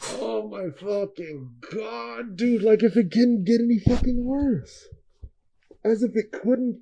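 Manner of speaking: soft, slow, high lament; despairing groan. Real, not acted. Hearing athletes left Donda Sports, soft despairing moan. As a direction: moan it out in disbelief. Reacting to more bad news.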